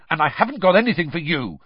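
snapping. A narrator's reading. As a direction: snap it out irritably. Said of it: "Harry snapped at Hedwig".

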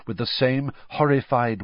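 ordinary delivery, nothing marked

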